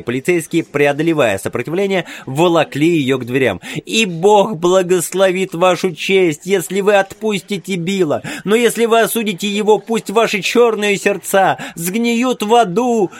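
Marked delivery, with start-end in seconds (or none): 3.8-13.1 crying out. A narrator's reading. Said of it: "продолжала выкрикивать".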